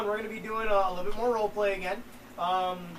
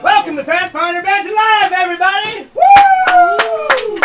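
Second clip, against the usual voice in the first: high, loud hyped opening; show host announcer; 13 semitones higher, 17 dB louder than usual. Acted. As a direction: hype the crowd like a host.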